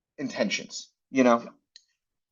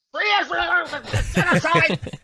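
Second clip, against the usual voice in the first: high, ironic protest shout; mock slogan shout; 13 semitones higher than usual. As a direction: yell it like a hammed-up protester.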